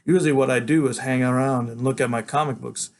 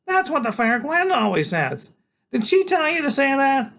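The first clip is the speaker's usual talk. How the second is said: higher, louder, grand little knight; small boy waking his big friend for a quest; eager boy genius; 13 semitones higher, 3 dB louder than usual. Acted. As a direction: rouse him like a tiny herald. Freak voiced.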